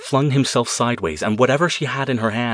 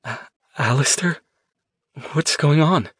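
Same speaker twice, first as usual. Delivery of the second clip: higher, halting confusion; bewildered; 6 semitones higher than usual. A narrator's reading. Woman coming back to herself, asking her boyfriend, bewildered. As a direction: wake into it not knowing what happened.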